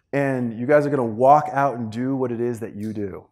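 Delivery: usual speaking voice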